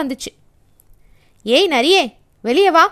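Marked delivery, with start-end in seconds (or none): 1.4-2.8 loud, bossy summons; commanding call. A narrator's reading. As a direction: order the fox out like a boss. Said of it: "எருது அதிகாரமா அழைச்சது".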